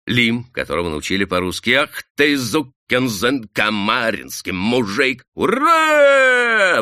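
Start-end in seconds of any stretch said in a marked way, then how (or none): 1.7-6.8 громкий высокий вопль, ура на самом верху; вопи, коверкая русские слова. Acted. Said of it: "вопил Хивин". (Хивин вопит выученные ругательства)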